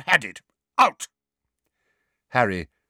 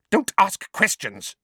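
snapping; 11 semitones higher, 4 dB louder than usual. Acted. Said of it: "Aunt Petunia snapped".

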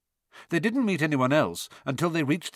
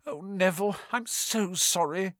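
miserable; 7 semitones higher than usual. A narrator's reading. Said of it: "said Hermione miserably".

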